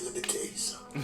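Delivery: hushed, secretive whisper. Acted. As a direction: lean in and whisper it. Raccoon leans in confidentially.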